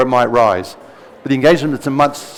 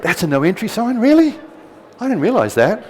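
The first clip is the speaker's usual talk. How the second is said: higher-pitched mock surprise, rising at the ends; naive surprise; 7 semitones higher than usual. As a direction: play the innocent tourist, voice climbing. Acts out his own naive surprise.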